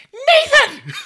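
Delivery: high shouted mom calling his name; man doing a woman's voice. Retold or imitated. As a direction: yell the kid's name like an angry mom. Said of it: "that is a pretty good imitation of my mom".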